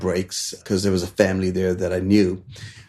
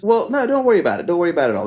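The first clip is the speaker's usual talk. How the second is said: higher, quick reassuring voice; 7 semitones higher, much faster than usual. Retold or imitated. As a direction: soothe the stranded customer, pitched up. Josh reassures customer, voiced.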